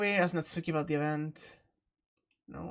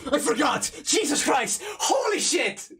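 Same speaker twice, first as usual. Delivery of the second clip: startled shouts after a jump scare; 8 semitones higher than usual. Real, not acted. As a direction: yelp in real fright. Jump scare in horror game.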